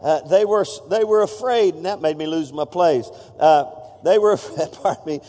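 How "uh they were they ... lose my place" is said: ordinary talk, nothing marked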